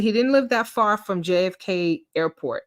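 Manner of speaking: usual speaking voice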